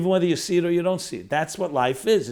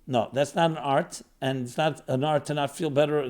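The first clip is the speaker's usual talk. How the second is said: ordinary delivery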